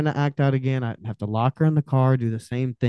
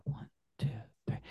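whispered count; 2 semitones higher, 14 dB quieter, faster than usual. Shown as a demonstration. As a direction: whisper "two, three" with no voice. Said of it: "And I'll do that".

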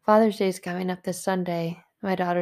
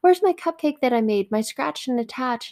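excited daughter; 7 semitones higher than usual. Retold or imitated. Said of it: "she's like".

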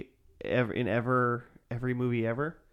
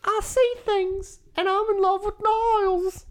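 high-pitched Mancunian housekeeper voice; Daphne; 4 dB louder than usual. Retold or imitated. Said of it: "Just imitate Daphne".

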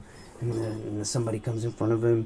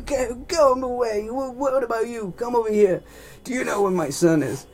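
high, loud blustering voice; hammed-up bossy rich man; impatient father; 13 semitones higher, 7 dB louder than usual. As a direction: bark orders in a high, put-on voice. Father barks at hospital staff.

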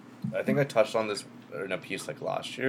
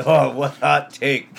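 laughing; 5 semitones higher, 9 dB louder than usual. Real, not acted. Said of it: "You gotta do a sexy voice".

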